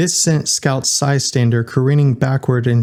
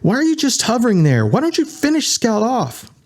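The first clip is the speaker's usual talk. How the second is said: higher, rapid-fire challenge; high, fast demanding; 11 semitones higher than usual. A narrator's reading. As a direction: press them, fast and high. Candy confronts the robots.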